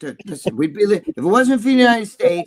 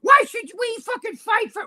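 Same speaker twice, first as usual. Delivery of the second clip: high mocking whine; mocking whiny quote. Host ridiculing viewers who won't fight, high mocking whine. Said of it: "start that".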